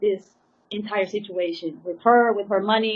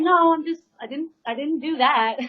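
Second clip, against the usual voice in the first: flustered denial; 6 semitones higher, 5 dB louder than usual. Retold or imitated. Denying the bloodshot eyes.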